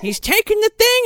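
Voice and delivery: very high mock-outraged protest; hammed-up high yell of disbelief; imitated yelling. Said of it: "she's, like, yelling at Crane".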